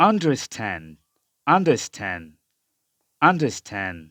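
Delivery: "understand" ending on n, like "understan"; silent final D. Shown as a demonstration. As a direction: drop the last d of "understand", American style. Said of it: "আমেরিকানরা এই ডি এর উচ্চারণটা করে না".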